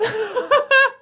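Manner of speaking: high loud burst of laughter; loud high cackle. Real, not acted. Laughing at quote.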